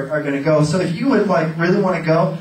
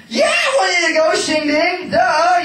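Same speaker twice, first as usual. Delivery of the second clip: God's voice; 11 semitones higher than usual. Retold or imitated. Preacher voicing God teasing him to go, high and shouting. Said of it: "He's like".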